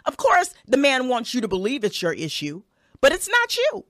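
ordinary talk, nothing marked

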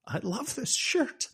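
high, slow strained whine; mock dying lament. Shot Jack seeing smoke on flannel, mock anguish. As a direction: imitate a shot man, drawn out high.

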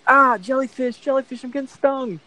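high-pitched imitated yelp of pain; mock screaming. Surfer stung by jellyfish yelping to friends, mock screaming. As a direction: cry out high, mimicking a panicked friend.

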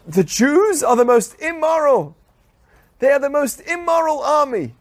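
hammed-up accusing shout; mock accusation. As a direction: parody the accusers' outrage. Mocking Israel's accusers.

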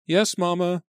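put-on high, light little-girl voice; girl answering her mother, high and chirpy; young girl's reply. A narrator's reading. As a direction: answer Mama like an eager young daughter.

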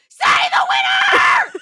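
high, drawn-out full-force scream; yelling. A narrator's reading. Narrator voices Sam demanding the contest result, yelling. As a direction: scream it at the top of your lungs. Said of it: "Sam yells out".

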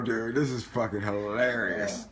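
ordinary talk

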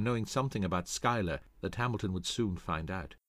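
usual speaking voice

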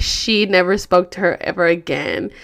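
usual speaking voice

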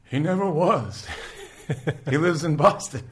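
high, loud quote broken by laughter; can't hold it together on the joke; laughing through the line. Retold or imitated. Retelling Jim's answer about his father, laughing through it. Quoting the punchline while laughing.

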